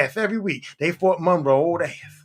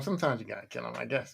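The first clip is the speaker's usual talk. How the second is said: low, much softer muttered concession; grudging aside; 11 semitones lower, 13 dB quieter, faster than usual. Real, not acted. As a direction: concede it under your breath.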